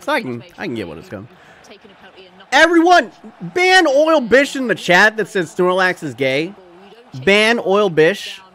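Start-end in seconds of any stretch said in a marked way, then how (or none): 2.5-8.4 loud, high, drawn-out proclamation; mock-outraged announcement. Streamer ordering chat to ban a user, loud mock outrage. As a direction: call out a slow, indignant order to everyone.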